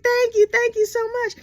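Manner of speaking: high, bubbly thank you; sweet new wife. Retold or imitated. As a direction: gush it sweetly.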